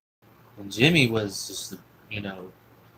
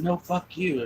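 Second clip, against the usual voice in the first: retold comeback, pitched much higher; angry comeback; 7 semitones higher, slower than usual. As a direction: throw the curse right back. Retold argument reply.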